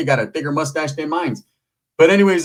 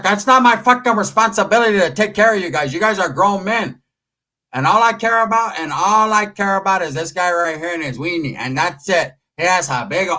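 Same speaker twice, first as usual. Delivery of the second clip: tia's voice; 5 semitones higher than usual. Retold or imitated. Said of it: "She's like".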